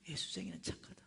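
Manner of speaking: low whispered restatement; hushed reflection. Real, not acted. Preacher reflecting on the vendor's realization, hushed. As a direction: let it sink in under the breath. Reflecting on the point just told.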